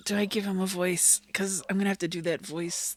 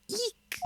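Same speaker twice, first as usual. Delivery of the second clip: very high, small squeak of fright; tiny high-pitched yelp; squealed eek; 4 dB quieter than usual. Acted. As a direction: squeak out a mock-startled "eek". Startled on seeing a corpse.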